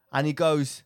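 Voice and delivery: normal talking voice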